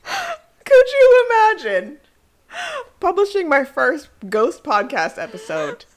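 high squealing laughter; words through giggles; laughing hard. Real, not acted. Laughing at ghost podcast idea.

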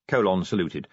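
ordinary delivery

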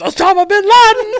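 very high, loud mock-alarm yell. Brett grabbing the phone to claim an attack, yelling. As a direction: scream it out like a false terror alert. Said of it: "grabs it and yells".

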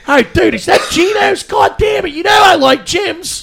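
put-on high, shouted Delco-accent gripe; funny Delco accent. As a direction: complain loudly in a hammed-up Delco accent. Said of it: "it's actually a really funny accent".

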